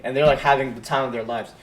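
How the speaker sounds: usual speaking voice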